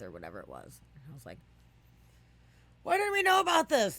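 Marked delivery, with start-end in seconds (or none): 2.8-4.0 very high, loud indignant yell; retold teen outraged nobody told her; outraged shout. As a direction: yell it in disbelief. Retold outrage at not knowing.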